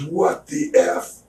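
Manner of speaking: high, slow, shocked reaction; shocked Kev. Retold or imitated. Kev sees his friend's swollen face, high and shocked. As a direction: recoil at the sight of the wound.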